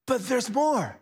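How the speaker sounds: hyped sales pitch, drawn out; infomercial voice. Pretend. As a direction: sell it like a TV ad. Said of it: "I sound like an infomercial".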